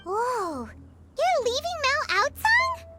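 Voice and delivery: high-pitched surprised question; astonished. Acted. Companion hearing the master will leave the mountain, astonished. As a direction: blurt it out in amazement. Startled by news of moving.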